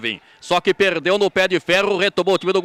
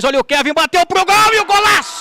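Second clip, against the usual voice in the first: explosive goal commentary; 5 semitones higher, 4 dB louder than usual. Real, not acted. Goal scored, narrator erupts.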